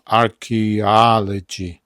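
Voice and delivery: Archaeology said slowly as ar-ki-AL-o-gy; Archaeology drawn out, stress on al; slow demo. Shown as a demonstration. Teacher demonstrating his own pronunciation to students. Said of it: "Ja bym to tak powiedział".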